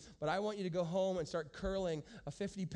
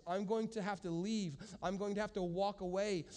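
ordinary delivery

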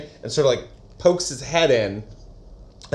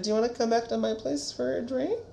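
higher, softer, hesitant invite; shy invitation; 11 semitones higher, 8 dB quieter than usual. Retold or imitated. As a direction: ask it quietly, hopeful. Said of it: "William's like".

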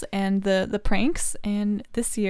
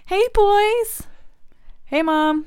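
put-on kid voice; 11 semitones higher, 4 dB louder than usual. Voicing kids arriving.

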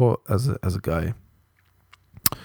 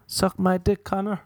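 high put-on voice; lightly mock the speaker of the line; raised, character-voice quote; mimicked quote; 10 semitones higher than usual.

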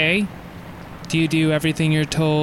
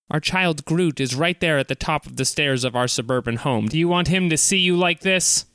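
ordinary delivery

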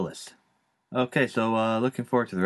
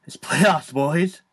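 excited cheer; 7 semitones higher, 7 dB louder, much slower than usual. Real, not acted. Hyping the playoffs to listeners.

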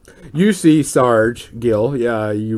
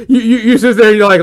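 ordinary delivery

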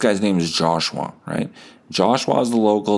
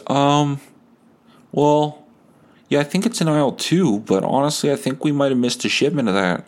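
put-on hesitant grocery boy; 6 semitones higher than usual. Grocery boy apologetic answer.

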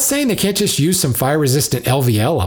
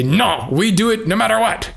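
put-on higher-pitched, lightly done French accent; mock French accent; 8 semitones higher than usual. Podcaster voicing stubborn French pride, in a mock French accent. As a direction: put on a half-hearted, proud French accent. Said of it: "that was my French accent".

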